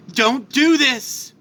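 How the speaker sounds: beg, drawing out each word; pleading. Acted. Father begs villain.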